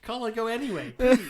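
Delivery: high playful begging; half-laughing mock plea; mock pleading. Playfully begging to visit unreal shop.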